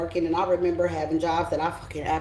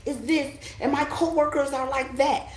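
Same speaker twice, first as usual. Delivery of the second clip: higher whiny complaining voice; mock the moaner about the boss; a higher mocking whine about coworkers; complaining worker; 6 semitones higher than usual. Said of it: "I get people all the time".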